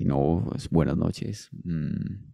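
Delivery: low, slow voice-message delivery. Shown as a demonstration. Colleague showing his put-on radio voice for a voice message, low and slow. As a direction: drop low and take your time, with long pauses. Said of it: "es como para dejar mensajes de voz".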